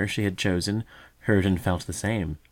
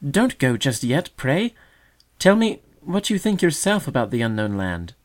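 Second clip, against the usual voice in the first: distressed pleading; 7 semitones higher, 6 dB louder than usual. A narrator's reading. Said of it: "quite distressed".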